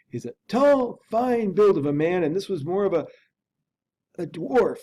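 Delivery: ordinary delivery, nothing marked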